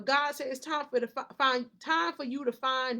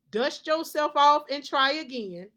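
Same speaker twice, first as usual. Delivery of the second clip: rousing; 5 semitones higher, 10 dB louder than usual. Real, not acted. Rousing exhortation to the viewer.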